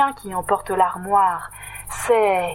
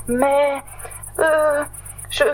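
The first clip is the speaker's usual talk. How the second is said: higher, frightened stutter; stammering; 7 semitones higher, much slower than usual. Acted. Totoche stammering to the wolf, higher and frightened. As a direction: trip over every word in fear. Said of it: "bégaye Totoche".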